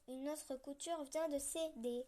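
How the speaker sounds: ordinary delivery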